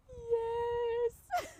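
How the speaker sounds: high-pitched mock sob; crying like a baby. Frightened walker answering the officers, imitated baby cry. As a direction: wail it out like a bawling baby. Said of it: "Like a baby".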